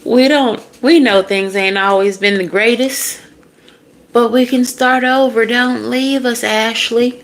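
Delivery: put-on high, loud country-accent pleading; hammed-up country twang, begging high. Family begging Ashley to stay, high country accent. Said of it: "I don't know why it's a country accent".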